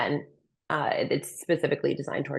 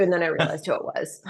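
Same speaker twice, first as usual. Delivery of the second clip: laughing; faster than usual. Real, not acted. Laughing about the encounter.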